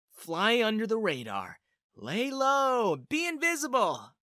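rueful, rising recital; exasperated self-reproach. A narrator's reading. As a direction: chant the rules at yourself, each drawn out. Mocks own broken rule in thought.

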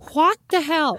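recalling Don's noodles, outraged. Real, not acted. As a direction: let the disbelief burst out. Outraged about the food.

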